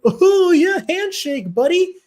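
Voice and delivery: very high, loud, squeaky taunt; mocking handshake taunt. Fighter offering a fake handshake, very high and loud. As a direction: squeal it like a cartoon trickster. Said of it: "And he's like".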